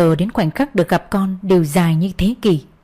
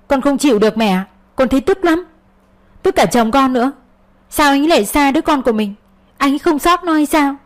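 bitter outburst; 6 semitones higher than usual. A narrator's reading. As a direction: let the resentment burst out. Venting anger to her mother.

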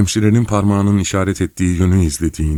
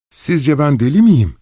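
much higher, louder and slow; angry flare-up; 9 semitones higher, 5 dB louder than usual. A narrator's reading. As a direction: snap it out, fear turned to fury. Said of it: "diye parladı".

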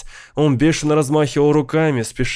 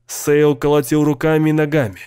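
ordinary delivery, nothing marked

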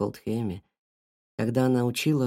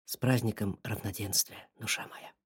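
softer, tender; quiet; 4 semitones lower, 8 dB quieter than usual. A narrator's reading. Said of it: "и тихо сказал".

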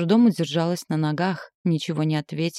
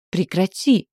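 sharp raised cry; understated squeal, a bit louder; mild shriek; 3 semitones higher, 5 dB louder, slower than usual. A narrator's reading. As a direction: cry out for it to stop. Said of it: "взвизгнула".

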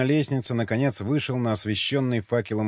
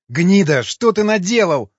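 loud, high accusing shout; furious yell; 6 semitones higher, 7 dB louder than usual. A narrator's reading. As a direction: yell it at the culprit, pitch climbing. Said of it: "заорал Васнецов".

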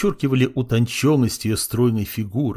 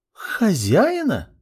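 high, slow, outraged echo; indignant disbelief; 10 semitones higher, much slower than usual. Acted. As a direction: repeat it as if insulted.

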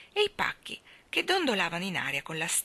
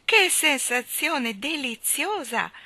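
deliziata; 8 semitones higher, 6 dB louder, much slower than usual. A narrator's reading. Jane vola felice.

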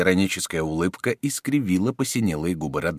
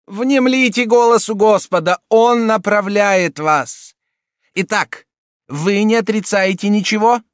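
high, loud solemn exhortation; much higher and louder, preach it; solemn cardinal; 8 dB louder than usual. A narrator's reading.

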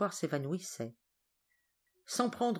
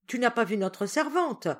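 higher, louder hurried query; tossed-out question; 6 semitones higher, 5 dB louder than usual. A narrator's reading. Solange questions the boy while running, tossed out loud. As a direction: throw the question at a passer-by. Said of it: "jeta mademoiselle Solange sans presque ralentir".

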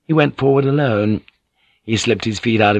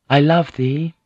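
higher-pitched female declaration; acted lady's voice, pitched up; woman's voice; 6 semitones higher than usual. A narrator's reading. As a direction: voice her saying it with a lifted pitch. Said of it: "she said in Latin".

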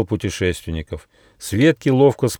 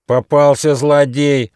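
loud, slow, high malicious cry; spiteful shout; 8 semitones higher, 7 dB louder, slower than usual. Acted. Deer shouts at the trapped tiger, spiteful. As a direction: gloat angrily at full voice. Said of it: "злобно закричал олень Замбар".

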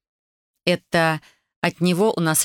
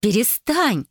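high-pitched exclaimed protest; exclaiming; 6 semitones higher, slower than usual. A narrator's reading. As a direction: cry out to make her sister stop. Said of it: "воскликнула Даша".

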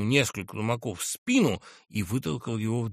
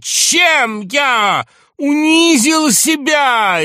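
high, loud, anguished appeal with drawn-out words; near-tearful shouting; 11 semitones higher, 12 dB louder, slower than usual. A narrator's reading. General challenges everyone to say how he disgraced himself, near-tearful shouting. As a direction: wail it out in wounded desperation. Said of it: "Иван Ильич чуть не плакал".

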